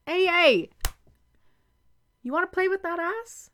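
loud, high teasing yelp; playful yelp. Acted. Teasing her grabby lover with a loud high yelp. Partner grabs her unexpectedly.

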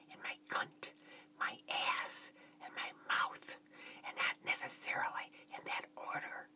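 intimate whisper in short phrases. A narrator's reading. Woman describes her needs to her lover, whispered. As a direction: whisper each phrase, pausing between them. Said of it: "continued to describe my needs".

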